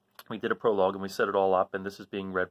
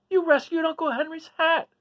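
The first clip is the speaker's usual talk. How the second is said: put-on high, louder girlish delight; 8 dB louder than usual. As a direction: praise the little dog with joy. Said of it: "Dorothy praises Toto".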